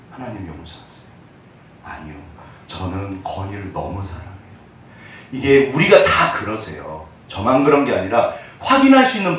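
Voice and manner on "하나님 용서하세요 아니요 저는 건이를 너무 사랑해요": much softer, broken confession; hushed prayer. Retold or imitated. Pastor retells his prayer to God, much softer. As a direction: plead softly for forgiveness.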